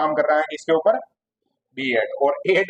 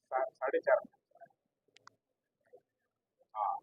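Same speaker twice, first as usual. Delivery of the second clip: much softer, away from the class; phone call aside; 10 dB quieter than usual. Real, not acted. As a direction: take a call quietly, off to the side. (Phone पर धीमी आवाज़ में, एक तरफ़ हटकर)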